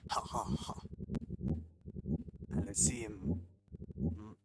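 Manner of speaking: mock-French chef laying down terms; hammed-up French customer, badly faked; French chef host. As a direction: issue the challenge in a fake French accent. Reads French chef character's lines.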